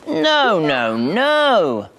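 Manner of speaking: ordinary delivery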